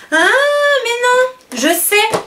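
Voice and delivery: high, very slow and drawn out; sudden realisation. Real, not acted. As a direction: stretch it out as the penny drops. Realises her own mistake.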